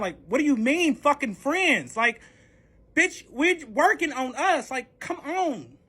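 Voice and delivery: high, drawn-out indignation; worked-up retold plea of disbelief; exasperated protest. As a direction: stretch the words in exasperated disbelief. Retold protest at being just friends.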